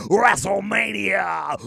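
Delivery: loud, slow, drawn-out hype yell; wrestling announcer shout. Acted. Repeating the event name for viewers, drawn-out announcer yell. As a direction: stretch it out like a ring announcer. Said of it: "I'll say it again".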